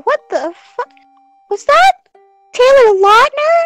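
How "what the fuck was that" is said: normal talking voice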